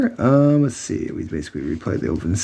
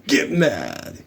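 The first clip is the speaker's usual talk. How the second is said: hint of witch; 3 dB quieter than usual. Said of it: "really deep voice".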